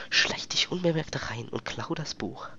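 hushed stealth whisper. Real, not acted. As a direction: keep it down while sneaking. Stealth section, speaking hushed.

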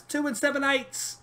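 high, drawn-out British commentator call; slow, high-pitched mock British accent; put-on British accent. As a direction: imitate an excited British commentator, stretched out. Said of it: "Why am I doing a British accent".

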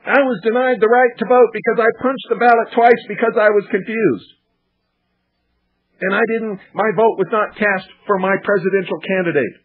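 a high-pitched mimic of a flustered voter; mock confused voter. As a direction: imitate a muddled grandma complaining. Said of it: "watching these poor people down in Florida saying".

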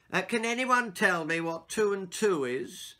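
lightly voiced schoolteacher asking the class; maths teacher. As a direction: ask it like a patient teacher. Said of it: "said Peregrine's maths teacher".

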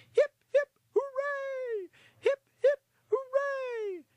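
very high, loud group hurrah; shouted cheer. Acted. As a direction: shout hip-hip-hooray high and punchy. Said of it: "they all shouted".

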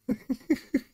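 kurzes, hohes Auflachen; ungläubiges Lachen. Real, not acted. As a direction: lacht ungläubig über so viel Dummheit. Lacht über die Figur. (hohes, überraschtes Lachen)

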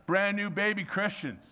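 usual speaking voice

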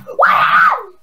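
very high excited squeal; squealing wow. Real, not acted. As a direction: shriek it in delight. Squeals at trap triggering.

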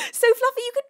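high, squealed shout that cuts out; very high, loud squeal, voice failing; mock scream. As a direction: scream it until the voice gives out. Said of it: "I lost my voice entirely screaming that".

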